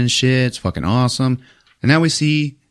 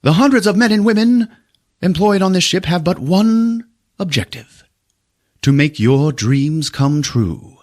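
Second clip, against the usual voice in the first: mock grand speech; 9 semitones higher than usual. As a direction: announce it like a showman host. Continues owner's speech.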